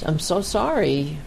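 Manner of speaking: ordinary delivery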